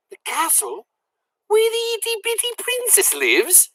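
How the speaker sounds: very high, breathless wonder; high childlike awe; awed little girl. A narrator's reading. Mig's wonder.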